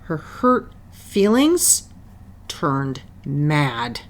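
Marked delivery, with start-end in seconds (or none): none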